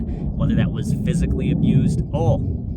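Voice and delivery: shouting over roaring jets; over jet engine noise. Real, not acted. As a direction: talk over a jet flyover. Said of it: "we got jets flying over".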